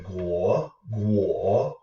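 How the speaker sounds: "guo" dipping, then rising, said twice; third tone. Shown as a demonstration. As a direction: drop and lift each "guo". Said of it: "make sure you get that third tone".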